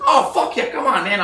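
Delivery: high, loud hype for a friend; excited welcome. Retold or imitated. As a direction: cheer him in like a buddy. Retold Donnie's excited welcome.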